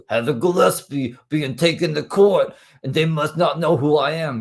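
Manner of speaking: mocking Heather's voice.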